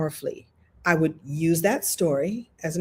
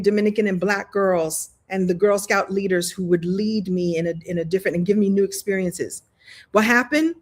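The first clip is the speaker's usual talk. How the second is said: usual speaking voice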